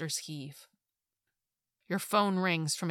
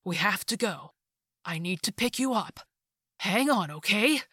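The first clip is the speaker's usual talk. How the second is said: urgent comfort; 3 semitones higher, 5 dB louder than usual. A narrator's reading. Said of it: "you say".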